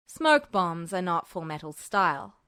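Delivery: as if it should be obvious; a touch of weary patience; stating the obvious. A narrator's reading. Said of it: "as though it should have been obvious".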